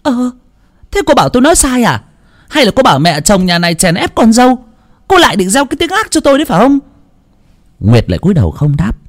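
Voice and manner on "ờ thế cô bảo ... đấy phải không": very high, fast, sharp accusation; hard-voiced mother-in-law. A narrator's reading. Mother-in-law sharply accuses her daughter-in-law. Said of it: "đanh giọng".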